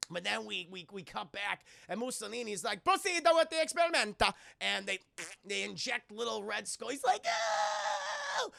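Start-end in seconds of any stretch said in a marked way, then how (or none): 2.8-4.3 high, loud hammed-up Italian accent; mock Italian accent. Mussolini ordering the injection in a mock Italian accent. 7.2-8.5 high, shrieking mock yelp; mock pained yelp. Little Red Skull being injected, mock yelp. Boy screaming when injected.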